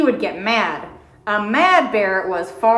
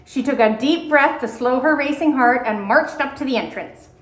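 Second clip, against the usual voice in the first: usual speaking voice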